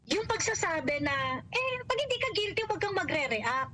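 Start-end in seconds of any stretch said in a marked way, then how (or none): 1.4-3.7 high, fast mocking parody of the congressman; a sneering imitation of the congressman; mocking quote. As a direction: mimic him with scorn, quick and high. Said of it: "ganyan-ganyan".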